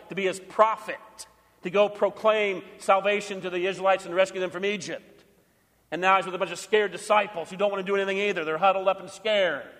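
usual speaking voice

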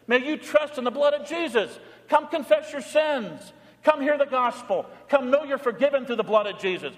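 high, loud pleading sermon voice; impassioned altar call; fervent preaching. Acted. Preaching at full force.

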